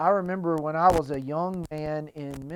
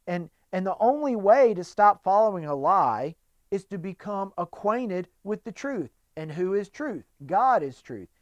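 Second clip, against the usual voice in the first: ordinary delivery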